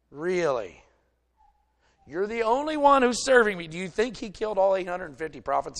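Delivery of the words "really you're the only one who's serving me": loud, higher mocking repeat; God mocking Elijah's claim to be alone; sarcastic echo. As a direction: throw his words back at him.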